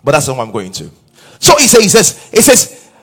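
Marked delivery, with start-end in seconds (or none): 1.4-2.9 loud, high, driving sermon delivery; fervent preaching. Acted. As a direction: preach it out to the whole hall, rising. Preacher building to full force.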